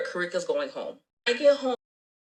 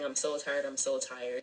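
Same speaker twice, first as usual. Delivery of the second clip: mock drowsy whine; 5 semitones lower, 7 dB quieter than usual. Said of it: "and he keeps saying".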